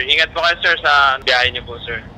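usual speaking voice

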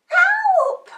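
very high, loud puppy yelp; panicked puppy cry; yelping for help. A narrator's reading. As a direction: shriek for help at full voice. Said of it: "at the top of her voice".

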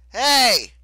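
high, sharp shout; loud interjection. A narrator's reading. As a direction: cut off the protest with a shout. Leo calls over the flames.

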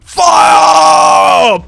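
loud shout of command. Real, not acted. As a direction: yell it at the crew. Ordering the cannon shot.

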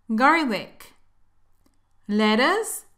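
ordinary talk, nothing marked

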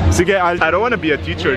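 usual speaking voice

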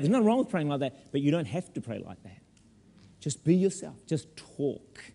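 ordinary delivery

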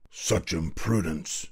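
slow, soft, indignant royal rebuke; restrained angry shout. A narrator's reading. King shaking an angry finger at the boy, quietly outraged. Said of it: "shouted the king".

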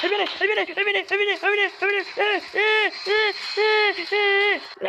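frantic high, loud repeated yelling; real panic. Real, not acted. Gamer spots the threat coming, panicked high yelling. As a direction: yell it over and over in a fright. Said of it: "me asusté esta vez".

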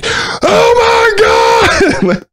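high slow agonized scream. Retold or imitated. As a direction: scream like it truly burns. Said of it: "you just start hearing screaming".

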